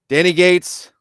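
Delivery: high, loud ceremonial call-out; showy dedication announcement; announcing. Real, not acted. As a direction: proclaim the name to the audience.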